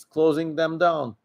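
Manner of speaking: ordinary delivery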